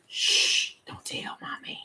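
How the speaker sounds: hushed, slow mock-secret whisper; secretive whisper. Cheater hushing someone about mommy, secretive whisper. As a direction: shush them, then whisper the secret.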